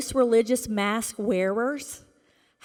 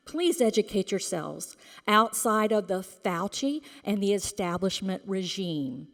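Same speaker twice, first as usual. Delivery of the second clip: usual speaking voice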